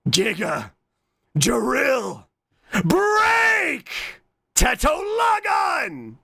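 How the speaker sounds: hammed-up battle cry, rising to high yells; anime attack shout. Streamer calling out a drill attack, hammed-up shout. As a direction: build word by word to a drawn-out high shout.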